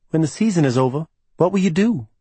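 voiced as a young woman, curious; Livy's voice. A narrator's reading. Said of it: "she asked".

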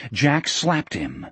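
ordinary delivery

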